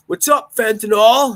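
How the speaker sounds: high, loud, slow jeering greeting; mock teasing shout. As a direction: call the nickname across the lot. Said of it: "He's just like".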